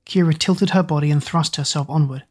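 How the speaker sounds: plain reading voice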